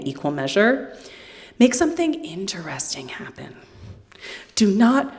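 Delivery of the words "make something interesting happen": soft unvoiced whisper at the screen; a hushed whispered demand; whispered plea. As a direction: beg it under your breath. Pleading with TV shows.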